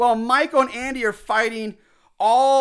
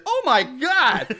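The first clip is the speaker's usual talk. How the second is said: high, slow mock-horrified exclamation; hammed-up shock at a gory sight; shocked bystander; 6 semitones higher, slower than usual. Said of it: "we hear a voice from off camera".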